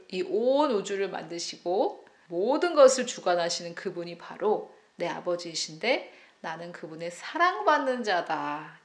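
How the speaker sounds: ordinary talk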